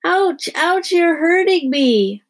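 high, loud, drawn-out wail; bellowing child. A narrator's reading. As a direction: bellow in exaggerated pain. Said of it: "Melody bellowed".

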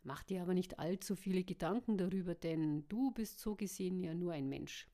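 plain reading voice

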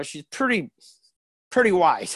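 ordinary delivery

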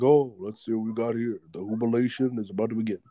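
a hint of a cheerful father reading off the gifts; imitating Cindy Lou's dad. Dad calls out to Cindy Lou, lightly voiced, quick.

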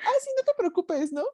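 very high, sugary mock politeness; sarcastic reassurance. Telling the stingy date not to worry, high and sarcastic. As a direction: play it overly sweet and high. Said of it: "Y le digo".

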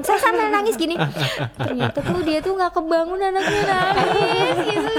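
high, loud words broken by laughter; laughing while telling. Real, not acted. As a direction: laugh through the bitter memory. Laughing while retelling the painful night.

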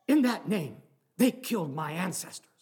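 the agent snaps back, stung and upset; upset retort. Retold or imitated. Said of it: "And he got very upset".

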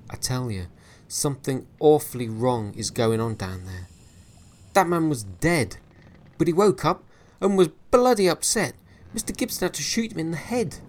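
building from earnest to high, loud and rushed; Harvey pleading with Williams, rising to frantic; alarmed insistence. Acted. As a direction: push harder as panic grows.

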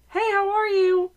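high, put-on cheery server greeting; customer-service greeting. Server greeting a table she resents, high fake-friendly. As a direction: sing out a server's hello.

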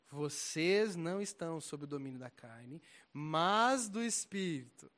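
loud, slow proclaiming of the verse; forceful preaching. Acted.

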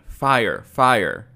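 loud alarm shout; fleeing attacker faking an alarm, loud. A narrator's reading. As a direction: yell it to sow confusion. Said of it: "began shouting".

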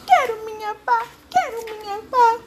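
very high, loud whining monkey cry; hammed-up squeaky monkey wail. A narrator's reading. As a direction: sob like a small monkey who lost everything. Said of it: "gemendo e chorando".

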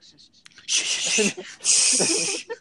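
exaggerated hissing shushes; hammed-up, forceful hushing; spitty shushing. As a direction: shush as if spraying spit. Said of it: "he was, like, spitting and shit".

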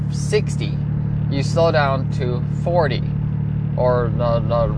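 ordinary delivery

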